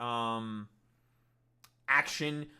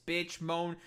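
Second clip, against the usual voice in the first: usual speaking voice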